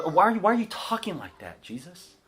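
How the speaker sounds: mock-whiny Thomas pleading with Jesus. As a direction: whine the question up high. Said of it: "he has this whiny voice about him".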